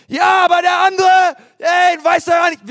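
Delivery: high, shouted mock protest; indignant objection. Listener objects that the other person is at fault, indignant mock shout. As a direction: blurt a loud, high-pitched defensive comeback.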